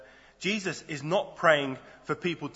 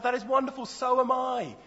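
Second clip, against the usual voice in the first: higher, gushing stranger voice; eager passerby; 10 semitones higher than usual. Acted. Passerby on a bridge cheerfully agreeing, higher eager voice. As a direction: sound delighted to agree. Joke passerby answers each reply.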